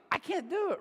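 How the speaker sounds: high-pitched comic bail-out; playful imitation of overwhelmed protest; mock helpless plea. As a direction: beg off in an exaggerated high voice. Wife begging out of upsetting talk.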